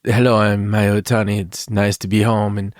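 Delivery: put-on, strange foreign accent; careful, oddly accented mock speech; Miya Itani's strange accent. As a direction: introduce yourself in an odd mock accent. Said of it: "I don't know why I talk with this accent".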